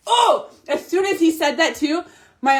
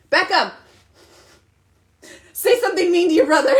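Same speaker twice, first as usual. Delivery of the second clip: laughing, higher, a playful order; laughing instruction; 6 semitones higher, 4 dB louder, faster than usual. Retold or imitated. Mom laughing as she tells son to be mean. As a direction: giggle through the odd request.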